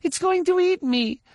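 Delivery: put-on high scared child voice; wailing little girl. A narrator's reading. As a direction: whimper like a frightened girl. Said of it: "she wailed".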